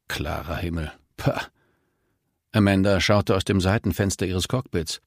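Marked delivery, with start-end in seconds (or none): 0.1-1.5 "Klarer Himmel" muttered softly, with disbelief; scornful mutter. Acted. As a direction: repeat "Klarer Himmel" under your breath, then scoff "pah". Pilot scoffs at forecast in fog.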